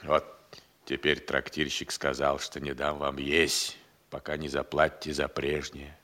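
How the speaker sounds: low, quiet, dejected delivery; glum report. Acted. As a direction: sink into a subdued, hopeless mutter. Hungry servant glumly reports innkeeper's refusal.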